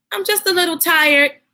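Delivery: a hint of a nervous kid stalling; little boy voice.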